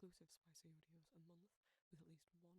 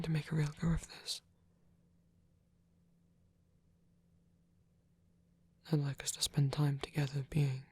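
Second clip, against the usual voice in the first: intimate whispered roleplay; 34 dB louder than usual. Acted. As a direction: whisper close and tender, like a shy caretaker.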